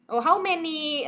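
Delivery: ordinary talk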